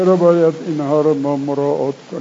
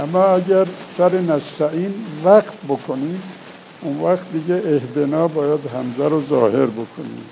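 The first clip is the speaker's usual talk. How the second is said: ordinary talk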